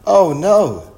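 loud, high storybook dismay; dismayed cry. A narrator's reading. Kipper hears they must go down, dismayed cry. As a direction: exclaim in acted dismay.

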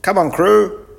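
loud, high commands; bossy professor. Acted. Professor orders the children to work, loud and high. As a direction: chivvy the crew in a high, loud voice.

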